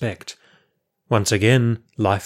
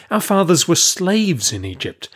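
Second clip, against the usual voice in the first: high-pitched, emphatic objection; indignant protest; 9 semitones higher than usual. A narrator's reading. Prophet correcting the refugees, indignant. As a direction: argue back, pitch jumping up.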